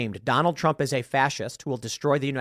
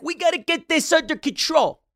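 much higher put-on mocking voice; imitating Michael Rapaport; 12 semitones higher than usual. Podcaster imitating Rapaport's rant about the far left, higher and mocking. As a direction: squeak it out like a mocking impression. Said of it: "I'm trying to imitate him".

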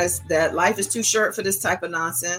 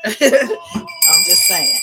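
laughing; 3 semitones higher, 6 dB louder, much slower than usual. Real, not acted. Laughing at own joke.

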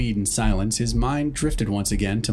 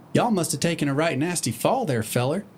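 Applejack voice; 5 semitones higher than usual. Acted.

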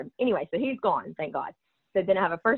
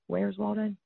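softer Southern repeat of "Where's Waldo"; accent demo; 4 semitones higher, 6 dB quieter, slower than usual. Shown as a demonstration. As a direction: say "Waldo" as "Waldeh", a bit quieter. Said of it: "your accent gets in the way".

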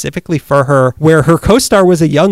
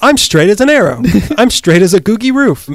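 mock denial; 6 semitones higher than usual.

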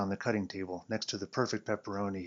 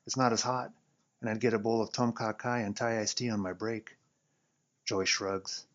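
ordinary delivery

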